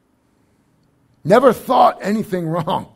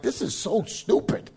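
muttered complaint; 11 dB quieter than usual. Retold or imitated. Retold frustrated complaint.

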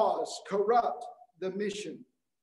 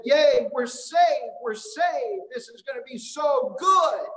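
higher, drawn-out gushing relief; mock jubilant cheering; 3 semitones higher than usual. Friends celebrating when Biden was elected, mock jubilant. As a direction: cheer it like a thrilled supporter.

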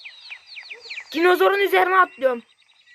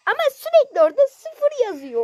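mocking sing-song; 9 semitones higher than usual. Mocking the dislike counter.

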